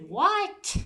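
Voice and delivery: high, rising retold "what?"; incredulous what. Retelling Shinichi's reply to the guy's question, incredulous. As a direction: jump up high in mock disbelief.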